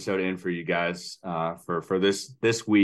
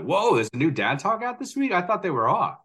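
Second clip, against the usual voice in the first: higher-pitched mock surprise; pleasantly surprised; 10 semitones higher than usual. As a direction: play an astonished fan spotting an unexpected release. Imagined listeners surprised by new episode.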